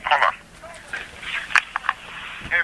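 normal talking voice

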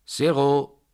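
high, loud announcing cry; ringing croupier's shout; croupier's call. A narrator's reading. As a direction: call out the winning number across the table. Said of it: "rief der Croupier".

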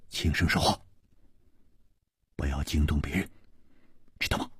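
low, soft and hushed; gentle voice. A narrator's reading. Chu calming the girl so nobody is disturbed, gently. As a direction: soothe her softly while cautioning quiet. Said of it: "再柔声说".

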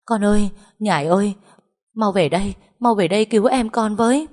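high, loud wailing plea; crying out. A narrator's reading. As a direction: wail to your daughter for help. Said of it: "khóc bù lu bù loa". (mẹ khóc lóc gọi con gái cầu cứu, giọng cao và to)

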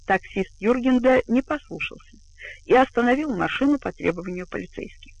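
ordinary delivery, nothing marked

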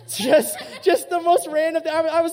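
a laugh bursting out loud; real amused laugh. Real, not acted. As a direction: laugh through the word. Laughing at bear story.

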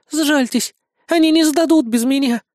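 high, anxious woman's voice; young woman pleading for mercy; frightened pleading. A narrator's reading. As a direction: beg in a high, hurried voice. Hostage begs gunman.

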